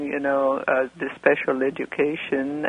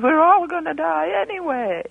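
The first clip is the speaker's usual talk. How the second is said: much higher, crazed throwaway voice; like crazy; 12 semitones higher than usual. Retold or imitated. Caller imitating her brother's careless reply, high and crazed. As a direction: toss it off like a madman. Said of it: "Like crazy".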